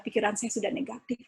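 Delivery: ordinary talk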